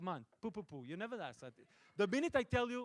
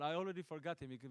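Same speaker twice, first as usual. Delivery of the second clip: normal talking voice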